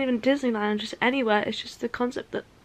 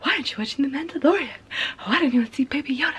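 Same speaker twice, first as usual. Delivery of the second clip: everyone asking; 3 semitones higher, faster than usual. Retold or imitated. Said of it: "everyone's like".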